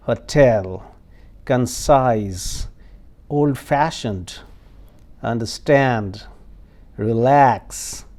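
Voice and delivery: hotel, concise, relax stressed loud on the last syllable; second syllable stress. Shown as a demonstration. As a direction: lean on the second syllable of each word. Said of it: "second syllable is stressed". (दूसरे syllable पर ज़ोर देकर)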